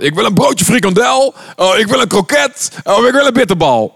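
high, loud excited customer voices; eager snack customers. Retold or imitated. Preacher acting out people ordering snacks, high and eager. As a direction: chirp the orders like hungry fans.

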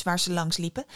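usual speaking voice

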